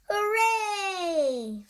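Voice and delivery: high, loud happy cheer. A narrator's reading. Skeleton and ghost celebrating the hiccups gone, high joyful cheer. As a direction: cheer out in triumph.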